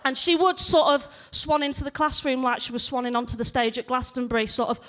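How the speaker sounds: ordinary talk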